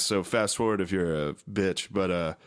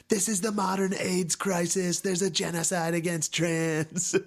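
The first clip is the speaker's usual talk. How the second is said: much higher, theatrical voice; 10 semitones higher than usual. Retold or imitated. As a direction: deliver it like a grand lament. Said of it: "he was like".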